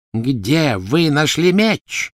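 menacing repeat. A narrator's reading. Bellatrix pressing the werewolf again about the sword. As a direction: drag the question out, threatening. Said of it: "повторила она".

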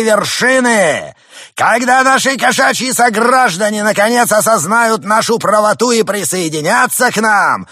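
slow build to a high shout; rising to a shout. Acted. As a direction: swell from loud to screaming. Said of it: "постепенно повышая голос".

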